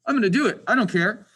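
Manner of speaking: a teacher loudly brushing off his own doubts; defiant. Real, not acted. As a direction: throw caution aside and just go for it. Defiant decision after doubting.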